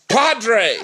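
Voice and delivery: mock military yell; Colonel Potter. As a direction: call out in a hammed-up colonel's voice. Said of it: "it's something Colonel Potter would yell".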